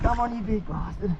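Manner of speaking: soft, strained under the breath; forced out while pulling hard; straining effort. Real, not acted. Crabber wrestling a stuck crab, straining softly.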